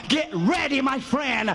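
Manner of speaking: ordinary talk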